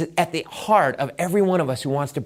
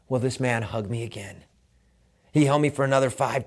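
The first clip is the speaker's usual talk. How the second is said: ordinary talk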